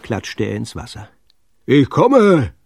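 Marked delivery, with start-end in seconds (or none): 1.7-2.5 loud, raised rescuer's call. A narrator's reading. Sven rushes his boat to the rescue, calling out. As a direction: call across the water, high and loud. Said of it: "rief der dicke Sven".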